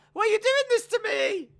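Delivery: loud, very high squealed plea; Mandy Rose impression. Reply to a bully, loud squealed mock plea. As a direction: wail it in a mock falsetto. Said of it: "just Mandy Rose on the floor going".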